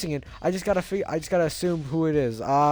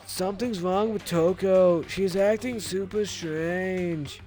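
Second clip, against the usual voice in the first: high, slow dazed stoner voice; 4 semitones higher, slower than usual. Acted. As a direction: drawl it out like a stoner. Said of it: "my stoner voice for you".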